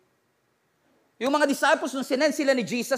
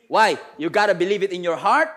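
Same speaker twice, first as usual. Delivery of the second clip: usual speaking voice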